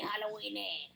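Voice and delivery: Halloweeny said low and soft, fading; spooky hush. Pretend.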